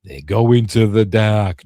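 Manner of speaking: loud, hammed-up demon pronouncement; ghostly voice. Mystery voice answering the girl in bed, loud mock-ominous. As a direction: announce it like a mock horror-film spirit. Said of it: "voice says".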